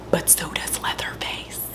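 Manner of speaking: hushed whisper. Real, not acted. A moment of silence for Gunnar, whispered. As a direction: whisper it like a solemn secret.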